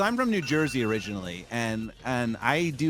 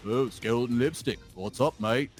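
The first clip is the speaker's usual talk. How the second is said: badly imitated British accent; mock British mate greeting; Tom from Topaz Gang; 4 semitones lower than usual. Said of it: "And then I talk to Tom".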